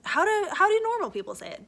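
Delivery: high, loud baffled question; pleading to viewers about a word; exasperated. Real, not acted. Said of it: "stumped, asking".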